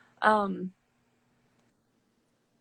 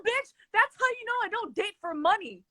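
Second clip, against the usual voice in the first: high-pitched, indignant outburst; emphatic interjection; 12 semitones higher than usual. Real, not acted. Guest cutting in about not dating for money, emphatic. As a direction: cut in high and proud over the other talker. Jokingly cuts in to proclaim.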